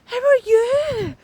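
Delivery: high, slow sugary mock greeting; mocking fake-sweet voice. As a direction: ham up a two-faced nice neighbour. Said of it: "the person that's always like".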